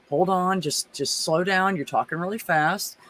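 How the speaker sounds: calm, respectful. Shown as a demonstration. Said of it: "And I do this voice".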